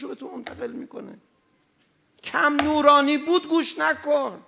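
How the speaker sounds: loud, high, rising sermon warning; fiery preaching. Acted. Preacher warning his audience off the singer, preaching hard. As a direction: thunder at the congregation from the pulpit. Preacher warns listeners about music.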